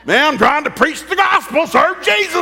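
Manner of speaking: Paul fed up with sinking ships, yelling out; exasperated Paul. Retold or imitated. Paul exasperated about shipwrecks.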